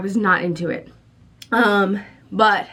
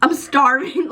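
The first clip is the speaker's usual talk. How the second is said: "I'm starving" pitched higher, a hammed-up desperate quote; mock dramatic starving; 7 semitones higher than usual. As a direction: play up the hunger, a touch theatrical. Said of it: "to, like".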